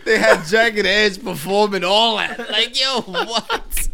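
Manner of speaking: high, loud, laughing disbelief; real incredulous laughter. Real, not acted. Host marvelling at the wedding performance, laughing in disbelief. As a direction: exclaim it while still cracking up.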